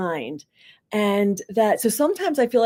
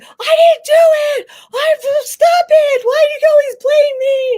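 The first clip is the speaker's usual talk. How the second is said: put-on screaming kid; 9 dB louder than usual. Boy to guidance counselor, imitated screaming protest. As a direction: wail it like a blamed child. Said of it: "he's screaming".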